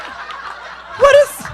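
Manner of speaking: very high, drawn-out bewildered squeak; a falsetto of utter disbelief; baffled squeak. Retold or imitated. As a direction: squeal it in total confusion. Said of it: "And I'm like".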